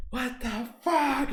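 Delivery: high, slow, drawn-out exclamation; stretched incredulous cry; mock outrage. After finally hitting the brake, high drawn-out disbelief. Acts own outburst after braking.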